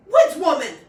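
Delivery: high, very loud yell. A narrator's reading. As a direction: yell across to Lou. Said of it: "the girl yelled at Lou".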